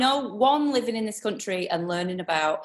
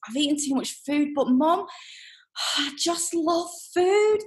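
put-on toddler daughter; 9 semitones higher than usual. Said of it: "She goes".

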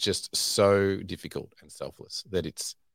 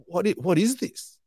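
slow, much higher, puzzled; retold bewilderment, raised and slowed; baffled inner voice; 11 semitones higher than usual. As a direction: ask it as if bewildered by yourself. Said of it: "I thought".

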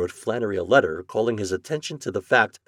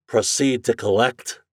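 ordinary delivery

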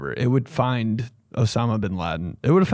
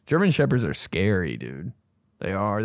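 ordinary delivery

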